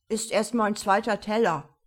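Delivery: lightly mimed mouthful of food; mumbling, mouth full. A narrator's reading. Man at the buffet defends his plate, mouth full. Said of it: "nuschelte er mit vollem Mund".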